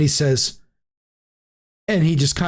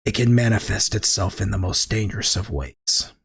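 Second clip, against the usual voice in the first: ominous warning; 6 semitones lower, 3 dB quieter than usual. Acted. Alistair Blackcloak darkly warning about the madness, low and quiet. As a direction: lower and softer, darkly ominous. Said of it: "his expression darkens".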